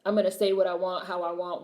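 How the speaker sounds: ordinary delivery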